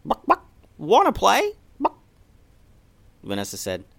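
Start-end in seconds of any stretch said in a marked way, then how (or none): 0.1-2.0 much higher, louder clucking chicken; squeaky chicken asking to play. Acted. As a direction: cluck it like a chirpy hen. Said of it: "one of the chickens said".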